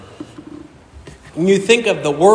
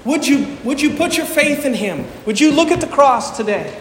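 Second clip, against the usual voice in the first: 1 pleading appeal; 6 semitones higher than usual. Real, not acted. Altar call appeal.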